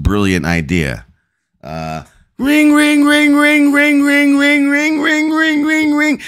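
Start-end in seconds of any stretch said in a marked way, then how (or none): 1.6-6.2 very high, singsong phone ringing. Pretend. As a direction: ring like a shrill toy phone. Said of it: "You have the most annoying phone on the planet".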